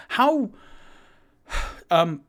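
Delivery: flustered, stammering high voice; nervous Toot Toot. Acted. Toot Toot greeting the thug Thornin, high and flustered. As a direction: fumble the words like a nervous little fellow.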